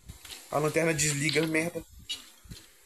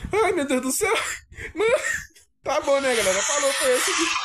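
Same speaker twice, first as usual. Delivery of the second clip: laughing; 7 semitones higher than usual. Real, not acted. Laughing while ending video.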